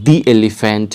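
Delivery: the elephant, slow, "the" as "dee". Shown as a demonstration. Teacher recapping examples for learners, "dee elephant". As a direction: stretch "the" to "dee" before elephant. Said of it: "এটার ক্ষেত্রে কী ছিল?".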